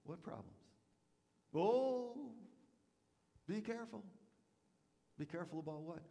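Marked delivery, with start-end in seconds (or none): 1.5-4.0 mock insinuating warning. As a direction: plant doubt with a fake worried warning. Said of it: "here's what you'll say".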